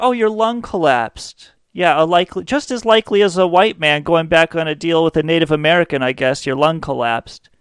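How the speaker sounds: hammed-up sarcastic "oh, sure" tone; mock disbelief. Assistant not buying the hospital excuse, sarcastic mock disbelief. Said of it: "was his tone".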